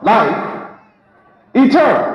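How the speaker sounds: ordinary talk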